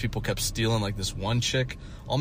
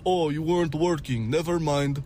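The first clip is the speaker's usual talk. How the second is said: put-on higher, lightly Russian-accented; Russian boss; 6 semitones higher than usual. Boss brushing off the accusation, higher mock Russian accent. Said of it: "And he goes".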